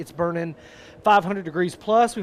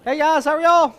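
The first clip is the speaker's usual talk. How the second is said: loud greeting; 11 semitones higher, 9 dB louder than usual. Real, not acted. Loud greeting to the live audience.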